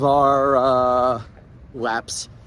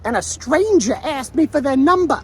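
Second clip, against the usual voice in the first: put-on high-pitched Long Island woman's accent; mock a Long Island stranger story, pitched up; 11 semitones higher than usual. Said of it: "she just used the word".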